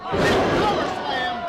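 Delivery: slow loud move call. Real, not acted. Calls a big move.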